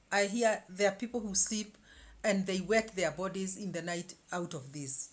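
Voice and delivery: ordinary delivery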